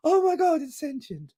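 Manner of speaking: high, hushed gasp of disbelief; gasping shock. Retold or imitated. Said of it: "instead of the positive reaction".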